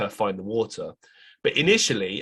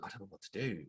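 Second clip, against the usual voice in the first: inner panic; 2 semitones lower, 16 dB quieter, faster than usual. Retold or imitated. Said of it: "I'm freaking out 'cause I'm like".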